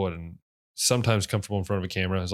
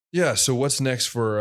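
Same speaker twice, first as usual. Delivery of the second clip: stretched through a yawn; words pulled long by a yawn; 5 semitones higher, 4 dB louder, slower than usual. Real, not acted. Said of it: "I hate yawns".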